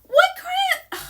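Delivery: very high, loud, gleeful squeal; relieved squeal. Retold or imitated. Retelling the relief after ginger tea, high gleeful squeal. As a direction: cry out as the pain vanishes. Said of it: "I was like".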